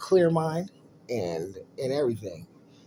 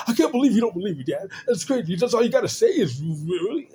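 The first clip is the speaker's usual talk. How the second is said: put-on high, loud whiny kid voice; higher, louder, like a hurt kid; mimicking upset son; 9 semitones higher, 6 dB louder than usual. Son upset that dad disbelieves.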